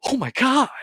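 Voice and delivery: high, stunned oh my God; mock-horrified father; shocked gasp. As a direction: realise it all at once. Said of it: "you're just like".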